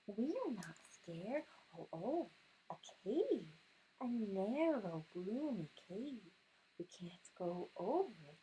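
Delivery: sing-song picture book rhythm; storybook read-aloud. Acted. Mother reading a picture book to her son, sing-song.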